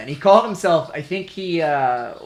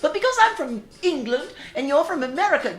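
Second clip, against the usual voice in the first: put-on high, fussy English-accented voice; mock English accent, pitched well up; 8 semitones higher, 4 dB louder than usual. [name] explains a word to an American, put-on English accent. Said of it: "do an accent".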